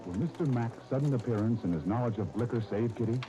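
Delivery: ordinary talk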